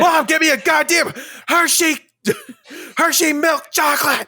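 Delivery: high, loud hammed-up demand; mock tantrum yell. Demanding chocolate from mom on camera, mock tantrum. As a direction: holler at mom in a pretend tantrum.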